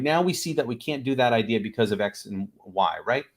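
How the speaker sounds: ordinary talk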